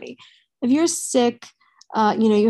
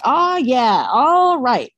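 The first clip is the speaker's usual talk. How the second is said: mock scream with drawn-out vowels; louder, higher celebrity catchphrase impression, held back from full volume; Matthew McConaughey; 3 semitones higher, 4 dB louder, much slower than usual. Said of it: "I sound like Matthew McConaughey in Dazed and Confused".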